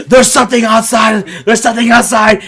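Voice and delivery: loud, panicked repetition; mock terror at something outside; frantic. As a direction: yell it like a terrified passenger. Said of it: "he was like".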